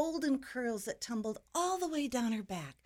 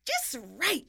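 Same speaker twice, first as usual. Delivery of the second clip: high, pleased sigh, then exclaim; raised, satisfied storybook exclamation; delighted exclamation; 5 semitones higher, 4 dB louder than usual. A narrator's reading. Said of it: "she exclaimed".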